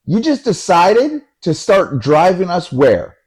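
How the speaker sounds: high, slow, incredulous demand; outraged disbelief. Retold or imitated. Friend confronts the driver over the detour, outraged.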